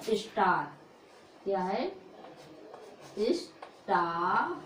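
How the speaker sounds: teacher drags out स्टार slowly for the class; slow model word. Shown as a demonstration. (स्टार को नीचे सुर में धीरे धीरे खींचकर)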